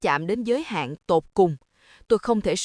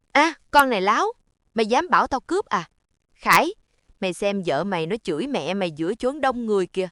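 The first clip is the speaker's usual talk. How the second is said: outraged; faster than usual. Acted. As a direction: flare up, then call the son. Mother-in-law flares up, calls son.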